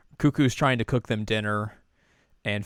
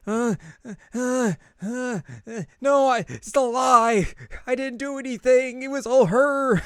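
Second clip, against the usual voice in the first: high, loud stammering panic; stammer, then blurt it out in high-pitched protest; panicked confession; 6 dB louder, slower than usual. Retold or imitated.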